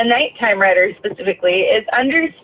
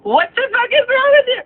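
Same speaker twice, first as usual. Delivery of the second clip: imagined outburst; 2 semitones lower than usual. Retold or imitated. Bus driver imagining yelling at the runner, exasperated cry. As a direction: blow up at the reckless runner. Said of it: "I could have just been like".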